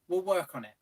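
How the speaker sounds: normal talking voice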